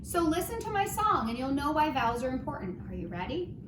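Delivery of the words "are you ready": ordinary talk, nothing marked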